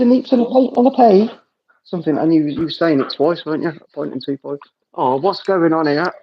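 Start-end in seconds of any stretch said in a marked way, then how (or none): 0.0-1.3 high, mock Glaswegian patter; Glaswegian accent. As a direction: play up a Glasgow accent. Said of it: "put on a Glaswegian accent".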